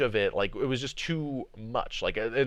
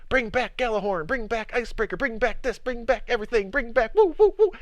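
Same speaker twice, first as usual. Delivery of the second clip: high-pitched imitation of eager fans, rising into whoops; mock fan clamour; 11 semitones higher, 4 dB louder than usual. Streamer imitating players begging for old weapons, mock excited clamour. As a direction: chant it like an overexcited crowd, building louder. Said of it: "other people that are like".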